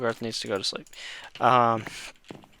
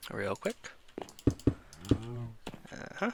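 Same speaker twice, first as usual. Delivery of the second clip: ordinary talk, nothing marked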